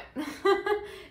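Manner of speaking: short, high burst of laughter; amused laugh. Real, not acted. Laughs at her own anecdote.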